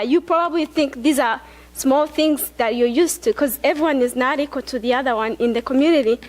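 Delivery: ordinary talk, nothing marked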